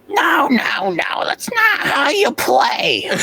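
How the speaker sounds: put-on high, nasal, whiny old voice; old nasally voice. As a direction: nag like a cranky old crone. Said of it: "in this old nasally voice".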